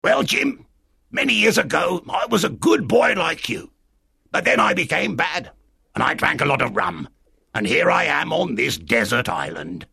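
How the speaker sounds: high, odd castaway voice; strange castaway. Acted. Castaway telling the boy how he went bad, strange voice. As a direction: tell it as an eccentric marooned sailor. Castaway tells his history.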